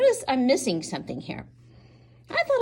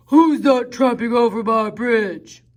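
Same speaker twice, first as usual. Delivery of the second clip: loud, mean and big troll voice; 7 dB louder than usual. Acted. Troll bellowing down at the little goat from under the bridge. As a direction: boom it out like a big ugly troll. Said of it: "the troll said".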